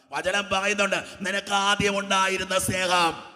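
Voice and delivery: loud, drawn-out scripture quote; ringing sermon proclamation; preaching. Acted. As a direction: proclaim the verse to the hall.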